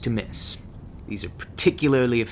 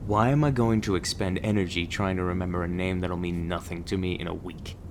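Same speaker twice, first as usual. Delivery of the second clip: low, unbothered monotone; jaded, low-pitched deadpan; bored dismissal; 5 semitones lower than usual. Acted. Jaded trainer dismisses rookie's name.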